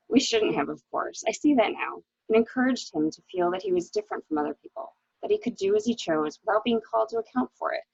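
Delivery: ordinary delivery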